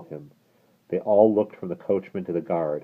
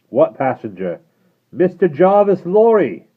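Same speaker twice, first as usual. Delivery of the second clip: loud, higher calling; shouted exchange; 12 semitones higher, 13 dB louder than usual. Acted. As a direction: call the name out slowly.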